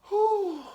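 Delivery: loud, high whooshing exhale; body-letting-go whoosh; relieved whew. Retold or imitated. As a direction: let out a high, airy "whew" of release. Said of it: "my body is just like".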